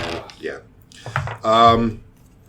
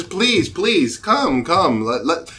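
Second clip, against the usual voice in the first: higher-pitched, insistent welcome; eager young stranger; 7 semitones higher, 3 dB louder, slower than usual. Acted. Stranger beckoning adventurers to his table, eager. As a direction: usher them over eagerly.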